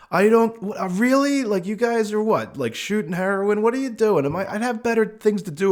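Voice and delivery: high, disbelieving outburst; incredulous. Retold or imitated. To the drugged-up guy who thinks he's a cop, incredulous and high.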